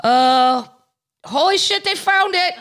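sudden high-pitched holy shit; surprised. Real, not acted. Reading the next story aloud, she yelps in high-pitched surprise. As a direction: genuinely caught off guard by the find.

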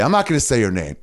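ordinary delivery

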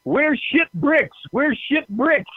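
slow, high, mild mock yell in a Korean accent; mock yelling lady. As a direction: call out the name slowly, half-frantic. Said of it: "she's yelling".

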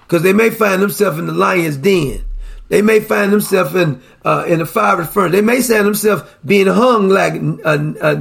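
ordinary talk, nothing marked

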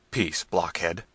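ordinary delivery

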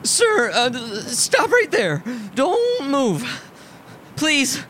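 high, timid, wavering plea; nervous little man losing his nerve; nervous fluttering voice. A narrator's reading. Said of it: "His voice fluttered without authority".

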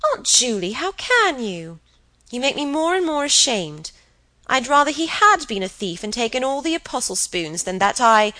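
high-pitched, heated reproach; crying out. A narrator's reading. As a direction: cry out ashamed at your aunt. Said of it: "But Helen cried".

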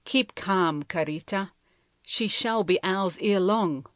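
ordinary delivery, nothing marked

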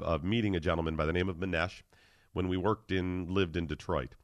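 usual speaking voice

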